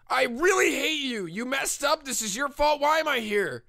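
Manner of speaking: high, exasperated outburst; hammed-up high-pitched tantrum; angry blaming. Blaming the others in anger.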